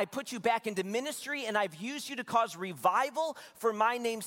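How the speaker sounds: God's rebuke. Retold or imitated. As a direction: list each grievance deliberately, building as an imitated divine reproach. God voiced rebuking Jonah.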